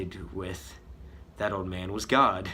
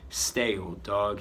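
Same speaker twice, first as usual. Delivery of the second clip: usual speaking voice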